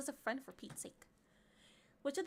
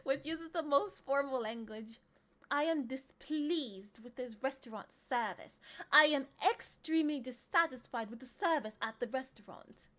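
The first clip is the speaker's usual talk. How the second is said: hammed-up formality; 6 dB louder than usual. Streamer reading formal restaurant complaint, pompously emphatic. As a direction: declaim it like a stuffy displeased diner. Hams up formal quiz sentences.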